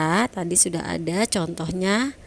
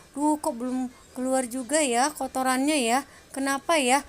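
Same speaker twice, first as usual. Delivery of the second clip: higher-pitched, mock-puzzled fretting; lightly acted wondering aloud, pitched up; puzzled grumble; 5 semitones higher than usual. Teacher imitating a child digging at a stubborn ear, puzzled grumble.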